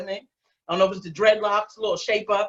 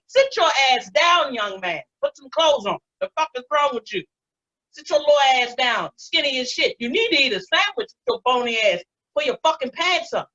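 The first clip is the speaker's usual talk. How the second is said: exasperated elder telling off youngsters, raised pitch; mock scolding; 5 semitones higher than usual. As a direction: scold them like a fed-up auntie.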